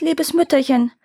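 high-pitched, pleading call; crying out. A narrator's reading. Daughters call after their vanished mother, mildly crying out. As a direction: mildly cry out for a dear mother. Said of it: "riefen beide aus".

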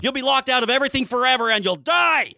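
hammed-up alarmist warning; mock scam urgency. Host parodying a phishing email to cohost, hammed-up panic. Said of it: "there's a sense of urgency".

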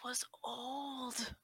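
hushed whisper. Acted. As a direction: breathe it out secretly. Whispered eerie reveal.

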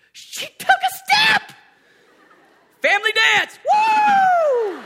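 very high, loud excited yell; excited shout. Retold or imitated. Dad telling his wife the baby walked, high excited yell. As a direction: shout the news to your wife. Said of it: "Renata," that's my wife".

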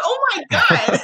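high, laughing oh my God. Retold or imitated. Hearing about small acquisitions, laughing in disbelief. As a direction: gasp it out mid-laugh. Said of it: "I'm like".

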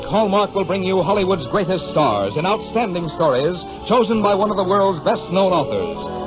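ordinary talk

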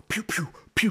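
high, sharp mouth-made blaster pews; gun noises. Retold or imitated. Recalling the game's gunfire, high mock pew pew. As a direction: fire off toy-gun sounds.

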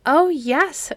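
high, eager "Oh, yes"; bursting with excitement; eager cry. A narrator's reading. Children begging the old woman for a story, eager cry. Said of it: "cried the children eagerly".

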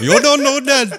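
high, loud hammed-up Indian accent on "You don't do that"; mock Indian accent. Friends copying the CEO line, in a mock Indian accent. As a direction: squeak it out in a silly Indian accent. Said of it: "it went a little Indian".

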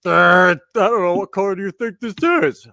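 loud, high clueless mimicry; mock your friend's confused reply; imitating co-host. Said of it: "And you're like".

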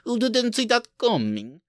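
very high, put-on Sokovian accent; mock Sokovian accent. Podcaster proving how Sokovians sound, high mock Sokovian accent. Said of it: "that's how Sokovians sound".

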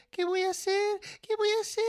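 high-pitched fretting; rising panic. Acted. Child alone fearing ruined Christmas, panicked.

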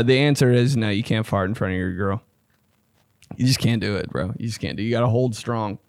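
ordinary talk, nothing marked

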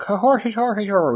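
put-on high-pitched caricature of an Irish accent; mock how people overdo the Irish accent, pitched right up; overdone mock Irish. Showing how outsiders imitate Irish people, exaggerated fake Irish accent. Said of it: "go the opposite way".

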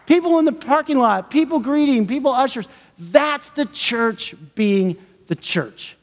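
ordinary talk

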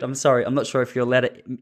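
normal talking voice

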